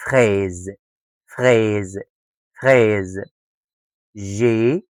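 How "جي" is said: ordinary talk, nothing marked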